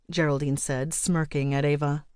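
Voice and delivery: plain reading voice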